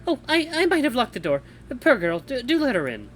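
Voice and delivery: high, lightly voiced character. Narrator voices a character aloud.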